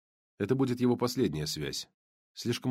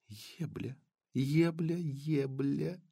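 seductive whisper; 4 semitones higher, 6 dB quieter, much slower than usual. Acted. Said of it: "И сказала".

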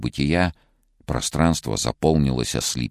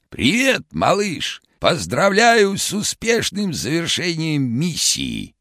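very high, hoarse cartoon shout; vintage cartoon voice; 4 dB louder, slower than usual. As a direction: shout it like an old cartoon Karlsson. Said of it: "хриплым, как в старом мультике, голосом прокричал".

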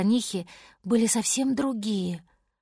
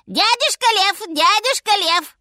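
high, loud monkey squeal; shrieking little monkey; 11 semitones higher, 6 dB louder than usual. A narrator's reading. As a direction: call out excitedly in a high monkey voice. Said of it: "закричала обезьянка".

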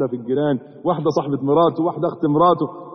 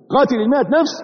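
قاتل المائة نفس raised and pressed, rising on نفس; stressed repeat; 6 semitones higher, faster than usual. Shown as a demonstration. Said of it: "الراجل اللي قاتل المائة نفس".

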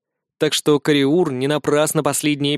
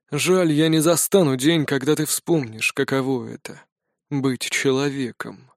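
put-on sad frail old wizard. A narrator's reading.

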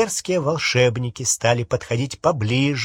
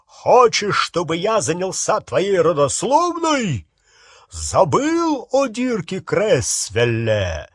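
raised, higher intimidating voice; angry threatening roar; 5 semitones higher than usual. A narrator's reading. Harry threatens the balding wizard, angry roar. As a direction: bear down on him, stretching the last question. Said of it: "взревел Гарри".

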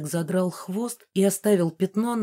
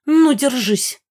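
high, loud ghost's warning; battle cry of an outraged ghost; angry threat; 7 semitones higher, 6 dB louder, slower than usual. A narrator's reading. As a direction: threaten her in a raised, high voice. Megara threatens mama in a fight.

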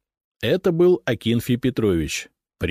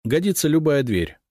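brief, decisive reply, pitched up; curt; 7 semitones higher than usual. A narrator's reading. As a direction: answer shortly and to the point. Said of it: "коротко сказал я". (коротко, решительно)

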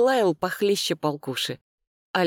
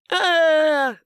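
long, loud, high mock squeal; imitated squeal; 12 semitones higher, 6 dB louder than usual. A narrator's reading. Brother imitates his sister's squeal, mocking shriek. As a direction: mimic a panicked shriek. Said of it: "изобразил он визг Алёны".

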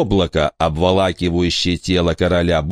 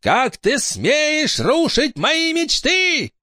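very high, slow shrieking witch; slower than usual. Acted. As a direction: screech it like a furious witch. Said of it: "ведьма ветра закричала".

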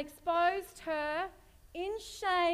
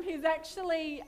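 usual speaking voice

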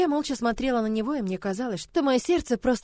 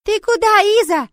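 put-on high, loud little-girl cry; wailing child; 11 semitones higher, 10 dB louder than usual. Little girl asking Iza where she's going, childish wail. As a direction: call out as a distressed small child.

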